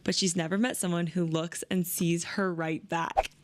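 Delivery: ordinary talk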